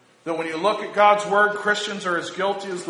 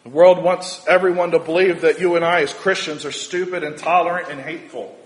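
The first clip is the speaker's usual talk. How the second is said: rising preacher cadence; 7 dB louder than usual. Acted.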